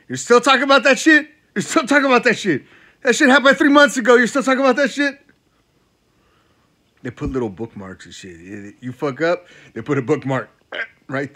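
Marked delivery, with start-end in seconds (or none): 0.1-5.2 high, loud nagging voice, hammed up; nagging girlfriend. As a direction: whine it like a fed-up girlfriend. Mock complaining girlfriend voiced.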